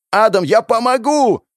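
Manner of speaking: loud, high rallying call; smiling shout. Acted. Hero leaping in to help Adam, joyful shout. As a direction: shout it with a smile. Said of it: "кричу я с улыбкой на лице".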